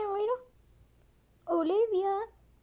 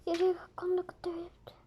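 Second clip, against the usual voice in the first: ordinary delivery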